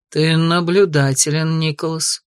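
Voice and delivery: low, slow and even; calm. Acted. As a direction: answer calmly. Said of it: "спокойно отозвался он".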